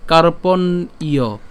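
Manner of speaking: "কার্পণ্য" very slowly, split as kar-pon-iyo; teacher sounding out "কার্পণ্য" in pieces; broken-down pronunciation. Shown as a demonstration. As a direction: hold the n in "কার্পণ্য", then add iyo separately. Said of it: "ভেঙে উচ্চারণ করি কেমন হবে".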